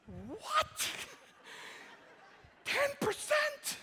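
high, shrill-free squeal of mock shock; shocked disbelief. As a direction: squeak it out like a child who can't believe it. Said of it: "she wept".

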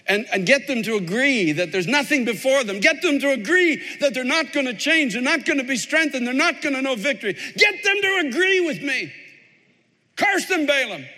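insistent urging. Acted. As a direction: build to a high, demanding cry. Voices Balak urging Balaam to curse.

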